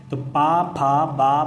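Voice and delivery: पा, फा, बा said loud and clipped with lips pressed; demonstrating पा फा बा with strong lip closure; lip sound demo. Shown as a demonstration. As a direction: pop पा फा बा off the lips for the class. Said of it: "पा बोल के देखो तो ये होठ मिलेंगे".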